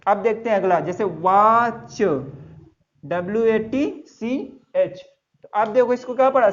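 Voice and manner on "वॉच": "वॉच" slowly, long vowel, separate ch; drawn-out ch pronunciation. Shown as a demonstration. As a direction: stretch "वॉच", then release the ch. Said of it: "सीएच को चा". ("वॉच" खींचकर, अंत में च)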